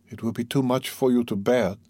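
plain reading voice

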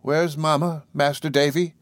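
lightly voiced evasive nursemaid; Peggotty. A narrator's reading. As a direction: stall by repeating it, rising at the end. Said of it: "repeated Peggotty".

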